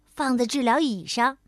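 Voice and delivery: plain reading voice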